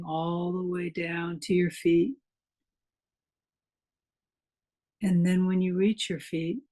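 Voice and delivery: calm meditation guide with long pauses between phrases; guided meditation. Acted. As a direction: guide listeners into relaxation, unhurried, leaving room to breathe.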